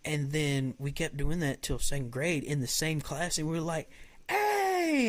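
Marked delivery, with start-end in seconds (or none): 4.3-5.1 high, bright call of hey; excited hey. Retold or imitated. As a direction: call out a cheerful greeting. Retold greeting in the hall.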